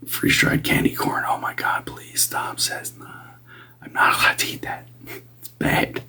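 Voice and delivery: breathy hushed whisper throughout. Real, not acted. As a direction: whisper it like a secret. Relaying spirit's teasing about candy.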